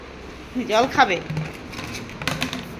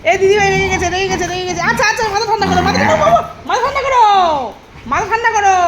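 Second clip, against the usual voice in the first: high coaxing; 6 semitones higher than usual. Real, not acted. Soothing an angry pet.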